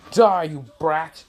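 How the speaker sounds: slow, high mock battle yell; angry attack cry. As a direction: hurl it at the kid. Acted attack by Minato.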